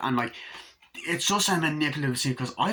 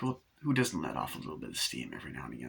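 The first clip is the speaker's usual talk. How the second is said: quick, soft, very low voice; low dismissive reply; 10 semitones lower, 8 dB quieter, faster than usual. Retold or imitated. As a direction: drop low and brush off the apology. Acting out brushing off an apology.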